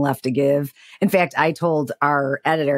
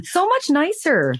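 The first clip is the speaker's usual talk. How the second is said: higher, slow and drawn out, gushing; 10 semitones higher, slower than usual. Real, not acted. Agreeing with friend about nicer staff, high and slow. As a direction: stretch it out, marvelling.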